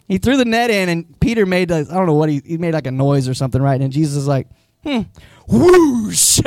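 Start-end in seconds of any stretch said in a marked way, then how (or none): 4.8-6.4 amused hum and whoosh. Retold or imitated. Jesus voiced amused at Peter.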